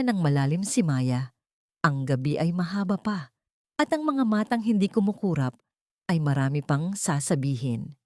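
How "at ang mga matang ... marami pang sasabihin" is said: usual speaking voice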